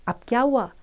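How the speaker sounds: plain reading voice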